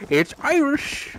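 high, drawn-out mock Irish accent. Quoting the cringeworthy line from the video, exaggerated slow Irish accent. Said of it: "in the most stereotypical Irish".

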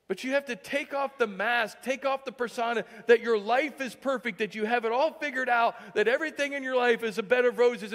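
fervent sermon delivery, pitched high; impassioned preaching. Real, not acted. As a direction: drive it home to the congregation. Preaching at full force.

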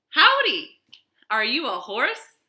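high, loud friendly howdy; cheerful cowboy greeting. Acted. Cowboy greeting a wagon, high and loud. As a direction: call out like an eager young cowboy. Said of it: "asked Cowboy".

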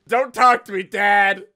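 mock moody teen. Streamer playing a goth teen snapping at dad. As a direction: sulk at dad like a teenager.

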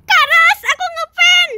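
gasp, then high excited squeal; starstruck fan. Acted. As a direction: gush like meeting your idol. Fan gasping at idol.

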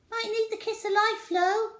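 high, fast cheeky voice; a colleague teasing, high and quick; teasing quip. A narrator's reading. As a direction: toss off a cheeky joke. Another voice teasing.

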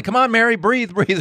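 high-pitched urgent urging; imitated yelling. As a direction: mimic rescuers yelling to revive someone. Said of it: "she heard the people yelling".

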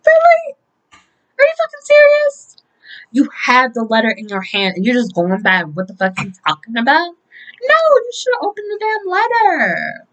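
loud, high-pitched incredulous rant; exasperated scolding. Retold or imitated. Fan scolds Trina for giving back letter, exasperated.

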